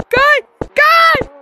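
high, loud shout to a teammate; urgent call. Real, not acted. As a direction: yell out for your friend, pitched high. Calling teammate in scary game.

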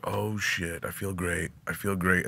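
very soft, low murmur to himself; hushed, dazed inner voice; quiet self-reassurance. Retold or imitated. Retelling edibles kicking in.

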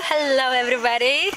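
ordinary delivery

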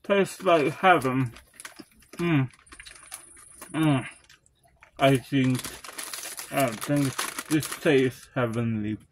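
chewing while talking; eating crunchy snack; mouth full. Real, not acted. Seen 3: Profile view; puffs brought to mouth, chewing throughout labelled words.

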